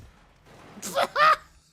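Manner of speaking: loud high shriek of laughter. Real, not acted. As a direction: throw your head back and shriek. Laughing hard at trailer.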